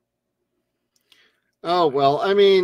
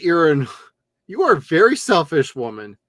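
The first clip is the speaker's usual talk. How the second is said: ordinary talk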